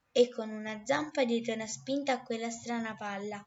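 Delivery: ordinary delivery, nothing marked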